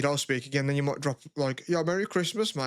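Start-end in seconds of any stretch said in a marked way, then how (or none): 1.7-2.7 higher-pitched friendly hail; imitated cheery greeting to a mate. Acting out friendly holiday texts.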